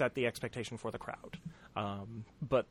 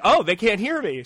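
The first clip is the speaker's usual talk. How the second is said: raised, higher retold voice; acted-out louder delivery; getting louder; 7 semitones higher, 9 dB louder than usual. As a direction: project as if the crowd can't hear you. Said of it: "started getting louder".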